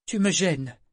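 sèche, haute et plus forte; lâcher ça d'un ton cassant; voix acerbe. A narrator's reading. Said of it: "dit Etta d'une voix acerbe". (ton acerbe et agacé)

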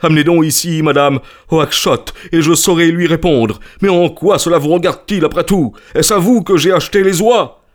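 high, angry tirade; furious merchant. A narrator's reading. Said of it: "montrait furieusement le poing".

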